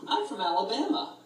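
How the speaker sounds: high-pitched eager second grader imitation; mock brightening up. Imitating her telling the guys her home state, mock brightening up. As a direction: chirp it like a kid who knows the answer. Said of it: "brightens up as a little second grader".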